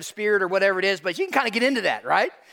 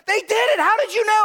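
high, louder mock cheering wife; hammed-up excited wife shout; wife thrilled; 12 semitones higher, 5 dB louder than usual. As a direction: imitate the wife shouting at the win. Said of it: "She's like".

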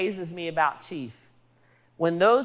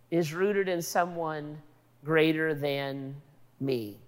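usual speaking voice

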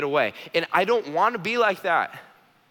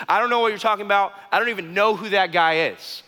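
loud, fast, higher panicked denial; 5 semitones higher, 6 dB louder than usual. Acted. Peter denying Jesus to the servant girl, loud, fast and panicked. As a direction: blurt it out in a rush of panic. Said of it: "Peter just panics and says".

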